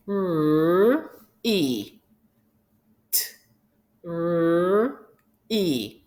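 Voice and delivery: TREE as separate sounds, t, r, ee; sounded out. Shown as a demonstration. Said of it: "to say each sound".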